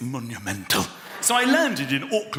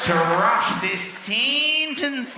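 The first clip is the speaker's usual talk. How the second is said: hugely drawn-out Australian vowels, climbing higher as it goes; slow Australian accent; 5 semitones higher, 3 dB louder, much slower than usual. Retold or imitated. Australian refusing to rush a sentence, exaggerated slow Australian accent. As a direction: stretch every vowel in a hammed-up Australian drawl. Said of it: "It's much slower in Australia. They take their time".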